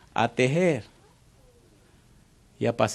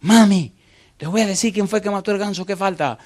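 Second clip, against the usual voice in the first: put-on high, loud eager child voice; excited tattletale sister; tattling little girl; 8 semitones higher, 7 dB louder than usual. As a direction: run in to tell on her brother. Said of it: "corriendo y le dice".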